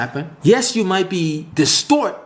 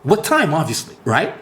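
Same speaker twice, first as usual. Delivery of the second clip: normal talking voice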